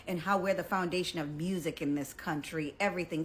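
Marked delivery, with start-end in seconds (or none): none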